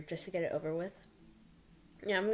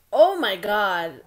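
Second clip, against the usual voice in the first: excited; 13 dB louder, much slower than usual. Real, not acted. Friend answers, excited.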